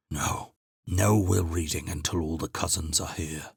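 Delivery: lightly aged, weary voice; a hint of a stubborn ancient; the old Kithriarch refusing; old man's voice. A narrator's reading.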